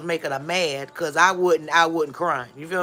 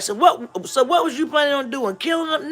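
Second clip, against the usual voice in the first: high, louder scolding mother's voice; mom scolding; 9 semitones higher, 5 dB louder than usual. Retold or imitated. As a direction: play the angry mom interrogating her kid. Mother scolding her child.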